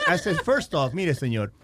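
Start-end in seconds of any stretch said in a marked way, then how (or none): none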